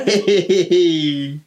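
short real laugh; a laugh at the comatose guest selfies. Real, not acted. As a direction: chuckle at the memory.

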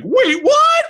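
high shocked shout. Retold or imitated. Family hearing the dog may be put down, shocked high shout. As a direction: yelp it out in disbelief. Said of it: "everyone's like".

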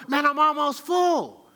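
impatient griping, dragged out; frustrated. Retold or imitated. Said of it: "now I'm frustrated because I'm going".

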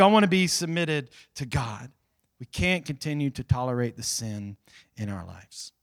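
ordinary talk

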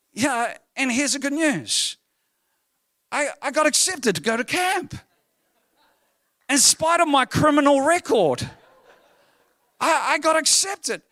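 high, loud, jubilant delivery; triumphant announcement. Real, not acted. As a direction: proclaim the punchline with elation. Triumphant reveal of good news.

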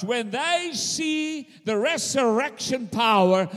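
loud, rising preacher's cry; preaching at full force. Acted. Preacher rousing the congregation, loud and fervent. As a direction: build the crowd up to a shout.

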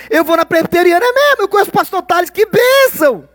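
high, bright fake churchy enthusiasm; overly sweet churchgoer imitation; mock gushing churchgoer. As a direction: gush like a pious church member showing off. Mimicking churchgoer small talk.

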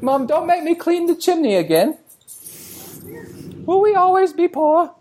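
very high falsetto British urchin; mock British accent. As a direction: plead to Mom as a squeaky British kid. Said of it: "That is an excellent British accent".

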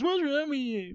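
high-pitched mocking echo of a complaint; mimicked scolding. Host mimics co-host scolding him, high mocking whine. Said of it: "I come in at the end and it's".

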